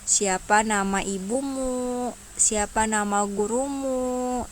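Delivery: questions read out slowly and clearly; slow, clear example questions. Shown as a demonstration. Teacher models slow, clear questions for her pupils.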